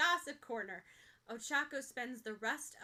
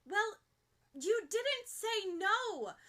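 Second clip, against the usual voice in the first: righteous; 10 semitones higher, 6 dB louder, much slower than usual. Acted. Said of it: "Ochako points out righteously".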